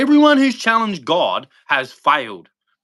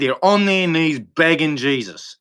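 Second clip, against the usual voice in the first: ordinary talk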